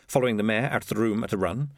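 usual speaking voice

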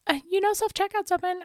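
high fast cashier impression; chirpy high helpful clerk. Imitating cashier.

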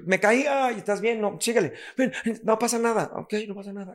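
a hint of a high, coaxing parent voice; soothing parent. Parent brushing off a child's fall, mildly high and coaxing. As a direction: lightly coax a fallen child to get up. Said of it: "A decir".

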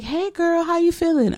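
very high, chummy doctor voice; imitated doctor's greeting. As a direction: mimic a friendly obstetrician way up high. Obstetrician greeting her, voiced.

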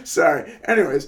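apologizing through laughter; laughing. Real, not acted. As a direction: giggle out the apology. Laughing after fly mix-up.

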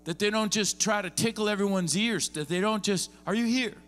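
high, loud, driving sermon delivery; fired-up pulpit preaching; preaching at full force. Real, not acted. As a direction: preach it hard to the room.

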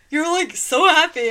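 excited. Retold or imitated. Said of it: "She's like".

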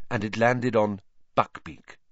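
plain reading voice

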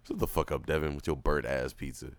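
ordinary talk

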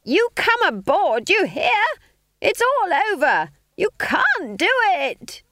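high, loud, drawn-out holler; urgent shout. A narrator's reading. Witch orders friend off burning broomstick, urgent shout. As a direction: holler orders as if over roaring flames.

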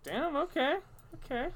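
high, loud "damn, okay"; mock stung reaction. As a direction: take the burn, high and loud.

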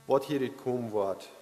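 usual speaking voice

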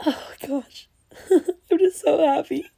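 real tearful laugh-cry, voice breaking; happy crying. Real, not acted. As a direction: choke out the words through happy tears. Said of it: "literally crying".

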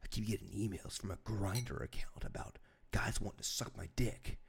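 whispering dad, hushed and low; imitated whisper. Son retells dad admitting the emails, in a whisper. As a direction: confess it under your breath like an embarrassed father. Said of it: "He was like".

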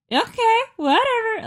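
high, dismissive "Okay, whatever". Retold or imitated. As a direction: shrug it off with a high, careless okay. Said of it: "And I was like".

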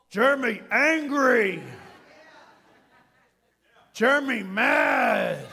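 loud mock monster roar; hammed-up, drawn-out brute voice; Hulk-style anger. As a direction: bellow it like an enraged comic-book brute. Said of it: "Unrestrained Hulk anger".

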